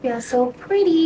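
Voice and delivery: high, delighted self-compliment; pleased with herself. Real, not acted. Vlogger showing her finished outfit to viewers, high delighted coo. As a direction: show off the look, beaming up high.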